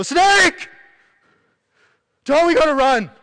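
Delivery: frantic shout at a friend beside you; panicked yell. Acted. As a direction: scream it to get your friend running. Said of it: "You yelled 'bush viper' right in my face".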